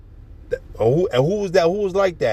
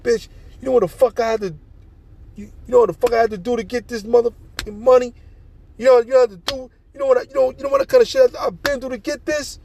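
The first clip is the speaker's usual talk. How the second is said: much higher, louder, furious retold yelling at his girl; mock angry rant; 12 semitones higher, 5 dB louder, faster than usual. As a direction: act out the man's mad outburst, loud and high. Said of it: "and he gets mad".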